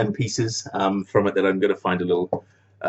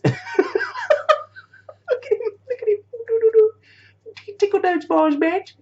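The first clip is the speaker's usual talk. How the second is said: much higher, squeaky cartoon voice with a laugh; silly toy voice; slower than usual. Vlogger voicing his Darth Stimpy figure, squeaky and mocking. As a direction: squeak it out like a goofy little villain toy.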